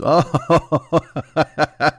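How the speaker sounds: long, drawn-out laugh. Real, not acted. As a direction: burst out laughing at your own idea. Laughs at own show idea.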